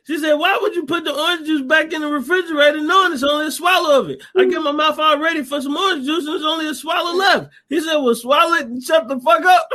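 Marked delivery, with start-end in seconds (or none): 0.3-7.5 high, run-on mock complaint; imitated scolding. Woman scolds Benny over the near-empty juice, mock indignation. 8.0-9.7 high-pitched mock outburst; imitated angry retort. Husband shuts down her juice complaint, mock angry retort.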